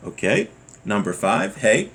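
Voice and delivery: ordinary delivery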